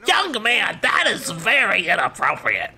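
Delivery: high, mock stern teacher voice; scolding teacher. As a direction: imitate an outraged teacher telling off a pupil. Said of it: "And she's like".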